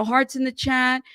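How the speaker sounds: normal talking voice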